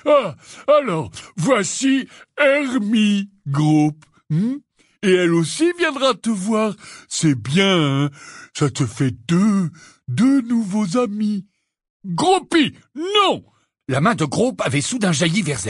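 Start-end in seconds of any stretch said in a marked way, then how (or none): 0.1-11.4 slow, gruff, higher; Hagrid coaxing. Acted. 12.1-13.4 high loud cry of alarm; alarmed shout. Acted. Giant lunges at Hermione.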